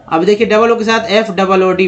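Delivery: ordinary talk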